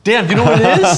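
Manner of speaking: loud, high showman's voila; hyped reveal. Acted. Host unveiling the surprise phone to his guest, loud and high. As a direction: present the gift like a magic trick.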